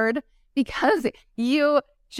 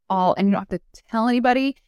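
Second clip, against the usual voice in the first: ordinary talk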